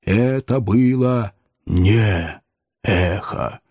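very slow, spaced-out spooky voice; ominous, measured correction; low goblin voice. A narrator's reading. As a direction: draw out each word as the unearthly stranger. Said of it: "послышался низкий голос".

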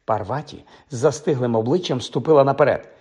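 usual speaking voice

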